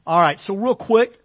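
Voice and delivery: usual speaking voice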